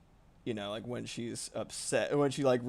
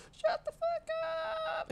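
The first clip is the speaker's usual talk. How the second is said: very high squeaky shouting cat; little cat voice. Retold or imitated. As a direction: shout it as a tiny angry cat. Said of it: "in her little cat voice".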